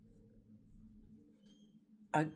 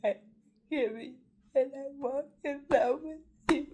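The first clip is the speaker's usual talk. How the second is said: higher and louder, forgiveness breaking out; the emotion surges through the repeat; emotional; 13 semitones higher, 6 dB louder, faster than usual. Real, not acted. Forgiving those who wronged her, rising emotional cry. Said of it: "I don't mean to get emotional".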